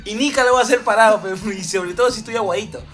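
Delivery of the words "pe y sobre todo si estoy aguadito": normal talking voice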